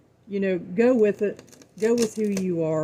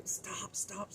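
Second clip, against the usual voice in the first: whispered; 4 semitones higher, 15 dB quieter than usual. Real, not acted. Urging viewers to stop self-criticism.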